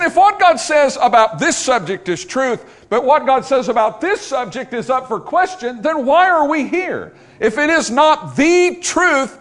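loud, high, driving preacher's climax; full-force preaching. Acted. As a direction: build the sermon to a ringing peak.

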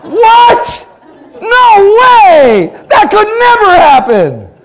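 very high, loud disbelieving yell; shocked shout. Retold or imitated. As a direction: yell in disbelief at the Super Bowl news. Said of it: "you'd be like".

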